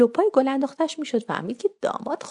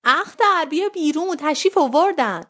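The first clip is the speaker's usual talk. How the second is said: high, raised call toward another room; 7 semitones higher, slower than usual. A narrator's reading. Mother calling Akhtar out as guests arrive, raised voice. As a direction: call her out with a lifted voice. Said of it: "رو به اتاق داد زد".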